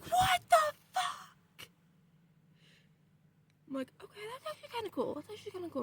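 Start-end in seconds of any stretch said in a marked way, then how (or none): 0.0-1.7 high, loud, drawn-out disbelief; retold shocked outburst. Retold shock at glowing red room. 4.0-5.8 high, quick, soft little voice; retold impressed aside. Retold sudden approval of the room.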